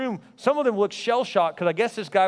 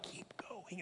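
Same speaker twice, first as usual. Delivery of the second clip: breathy whisper from the back; 27 dB quieter than usual. Retold or imitated. Leader whispering from the back of the room, whisper. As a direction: hush it, barely voiced. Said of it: "he's like".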